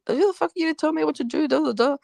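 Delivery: fast, high, hammed-up angry guys; mock angry retort. Retelling the guys snapping at her mum, mock angry and high. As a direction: mimic the rude guys brushing her off.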